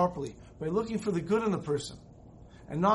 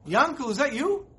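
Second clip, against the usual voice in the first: surprised call; 6 semitones higher, 4 dB louder than usual. Retold or imitated. Rebbe spots old friend passing.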